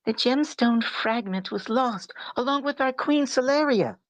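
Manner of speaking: put-on sweet young girl; girly voice. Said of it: "I wanna hear your girl voice".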